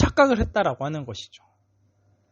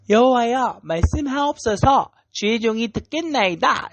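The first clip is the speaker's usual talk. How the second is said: put-on higher, sweet little-boy reply; cute child voice; 7 semitones higher than usual. Young Samuel answering God sweetly and cutely. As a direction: sound like an eager small child answering. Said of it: "이렇게 아주 귀엽게 얘기를 했겠죠".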